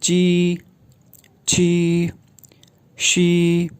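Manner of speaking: ची छी शी read as one row; ची छी शी, each distinct. Shown as a demonstration. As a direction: row of three syllables read together. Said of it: "मैं एक साथ पढ़ूँगा".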